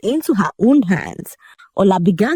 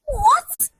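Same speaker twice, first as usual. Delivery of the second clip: very high-pitched shriek of shock; high screamed question; screamed disbelief. A narrator's reading. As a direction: squeal it, rising in disbelief. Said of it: "Ola screamed".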